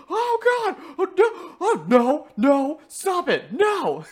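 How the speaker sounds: high, loud yelps of dismay, breaking into laughter; retold panicked yelling. Player re-enacting yelling at the game, high mock-panicked yells. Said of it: "she just hears me yelling".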